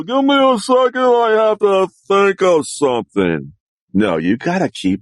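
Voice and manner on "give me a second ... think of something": high, flustered stalling voice; flustered host. Retold or imitated. Host caught on air, stalling in a high voice. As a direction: stall like you've gone blank.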